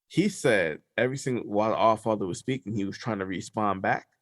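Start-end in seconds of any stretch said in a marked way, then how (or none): none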